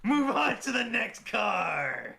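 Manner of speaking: high, drawn-out mock holler; mock announcer call. Echoing a co-host's call to move on, hammed-up and drawn out.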